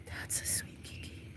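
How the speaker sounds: whispered sweet nothing. Real, not acted. Owner whispering to the kitten she holds, soft whisper. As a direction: breathe it to the kitten.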